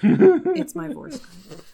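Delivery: slow, high, loud; mock dramatic. Real, not acted. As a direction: drag it out dramatically. Mock agreement.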